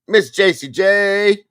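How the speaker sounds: high, loud, slow drawn-out name; excited call-out. Acted. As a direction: announce her like a star on stage. Calling out a viewer's name.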